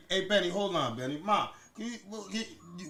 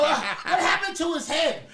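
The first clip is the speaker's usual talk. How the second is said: slower, higher puzzled question; mock concerned adults; 5 semitones higher, slower than usual. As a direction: ask it like a worried teacher. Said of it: "They would go".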